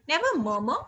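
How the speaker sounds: ordinary talk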